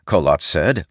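ordinary delivery

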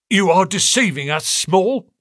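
high, hard accusing voice; stern inspector. A narrator's reading. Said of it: "said Athelney Jones sternly".

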